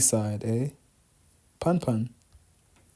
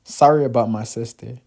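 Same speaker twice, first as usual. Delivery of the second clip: ordinary delivery, nothing marked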